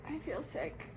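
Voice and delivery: very soft, feeble voice; weakly. A narrator's reading. Sarah arriving at the new school, speaking weakly. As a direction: say it faintly, as if queasy. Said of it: "said Sarah weakly".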